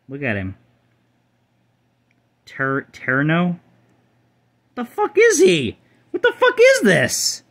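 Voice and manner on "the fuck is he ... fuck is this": very high, slow, squeaky cartoon voice; high squeaky bewildered shriek, a touch louder; squeaky mock outrage. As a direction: squeal it out in fake disbelief. Baffled by a weird card.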